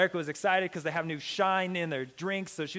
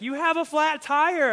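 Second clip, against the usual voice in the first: high, slow, obvious tone; hammed-up know-it-all driver; mocking the helpful guy; 10 semitones higher, 8 dB louder, slower than usual. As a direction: play the stranger stating the obvious. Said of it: "He's like".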